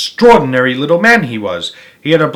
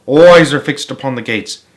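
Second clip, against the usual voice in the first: usual speaking voice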